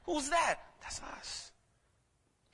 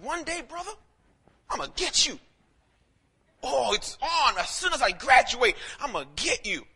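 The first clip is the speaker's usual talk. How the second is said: high, hammed-up vow of revenge; mock threat; 6 semitones higher, 6 dB louder than usual. Preacher retells vowing revenge on school bully, mock threatening. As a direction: swagger through a playful, raised-pitch threat.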